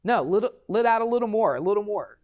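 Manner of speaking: lightly mocking the guy egging him on; instructor urging. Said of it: "the guy kept on saying".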